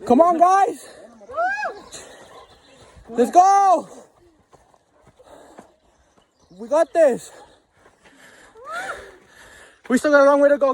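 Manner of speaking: shouting encouragement up the trail; rally the group, loud and high; loud cheering. Real, not acted.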